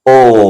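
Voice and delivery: full ओ from the navel; belly o. Shown as a demonstration. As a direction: round ओ from the belly. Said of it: "ओ बोला नाभि से आवाज".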